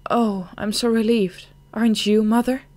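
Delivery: daughter's relieved cry, a touch high. Acted. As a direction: sigh it out with relief.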